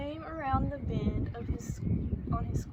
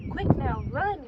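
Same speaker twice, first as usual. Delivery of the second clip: louder, urgent call to run; 2 semitones higher, 8 dB louder than usual. A narrator's reading. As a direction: call out to the girl to hurry after the bee. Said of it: "Grandpa called out".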